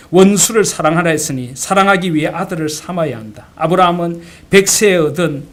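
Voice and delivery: voiced father, loud and insistent; father's firm exhortation. Pastor father answering his protesting daughter from scripture, firm exhortation. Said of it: "손양원 목사님은 그런 딸에게 말합니다".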